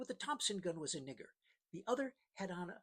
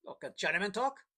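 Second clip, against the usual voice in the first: sneering question, a bit louder; nasty; 4 semitones higher, 7 dB louder than usual. A narrator's reading. Pancho taunting the captain about smuggling Chinese, mildly nasty. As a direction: needle him with a mild sneer. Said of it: "Pretty nasty".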